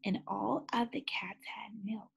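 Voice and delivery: unvoiced whisper. Real, not acted. Reader whispers the happy turn to the children.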